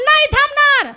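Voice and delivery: very high acted refusal; hammed-up stubborn huff; defiant old man. Old man refuses wife's call to wait, defiant.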